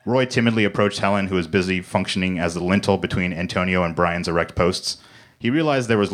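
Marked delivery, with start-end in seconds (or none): none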